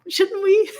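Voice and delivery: high, loud mock agreement; dripping sarcasm; sarcastic. To friends about tampons, high sarcastic voice. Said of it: "And I'm like".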